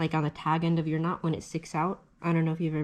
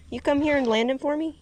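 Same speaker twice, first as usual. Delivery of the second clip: calling from far away; 10 semitones higher, 5 dB louder than usual. Real, not acted. Calls to someone to help land the fish.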